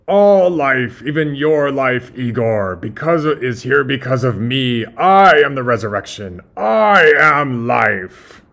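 loud megalomaniac rant; Doctor Frankenstein. Acted. Doctor claims all life before Igor, booming and grandiose. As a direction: build to a huge, drawn-out final proclamation. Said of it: "starting with Doctor Frankenstein".

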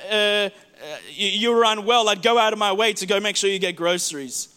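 usual speaking voice

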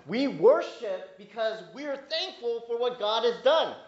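high, loud, fervent preaching; full-force preaching. Acted. Pastor urges congregation toward praise, fervent preaching. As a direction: rise up and preach it.

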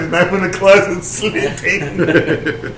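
laughing through the words. Real, not acted. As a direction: say it while laughing. Laughing at punchline.

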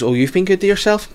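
high, cheeky pensioner tease; teasing old woman. Retold or imitated. Old woman teasing the visitor about his tummy: high cheeky voice. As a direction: needle him about his weight, sing-song. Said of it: "she goes".

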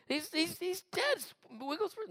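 mock a horrified pastor's squeaky alarm; freaked-out pastor. Pastor appealing to the healer, freaked out. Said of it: "The pastor was freaked out".

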